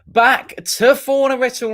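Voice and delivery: high, slow, hammed-up host welcome; mock grand hello with a laugh; showman's greeting. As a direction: ham up the opening like a showman. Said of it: "hammed-up show opening".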